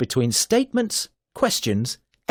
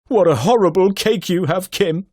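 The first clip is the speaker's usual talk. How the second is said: loud, higher horrified exclamation; 6 semitones higher, 5 dB louder than usual. Shown as a demonstration. Teacher demos a horrified exclamation, louder and higher. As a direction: recoil in mock horror at the cake. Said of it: "or even horror".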